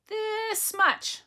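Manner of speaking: high, loud little voice stretching out; eager child. Acted. Little hare showing father how much, eagerly. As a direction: show how big with a squeaky burst.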